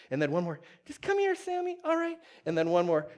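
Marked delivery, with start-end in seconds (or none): 0.9-2.2 very high pet-talk voice; coaxing a dog. Retold or imitated. Owner guiding his dog one more step, high coaxing voice.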